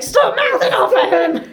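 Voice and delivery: high-pitched comic scolding; urgent hushing outburst. Acted. Chorus silencing one of their own, high urgent scolding.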